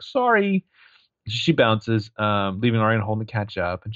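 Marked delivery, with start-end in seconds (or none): 0.0-0.7 high, sing-out sorry; breezy mock apology. Hannah excusing herself to take a call, high breezy apology. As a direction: toss out a high-pitched apology. Acted excuse while bouncing off.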